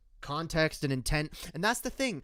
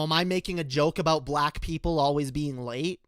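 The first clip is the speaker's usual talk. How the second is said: normal talking voice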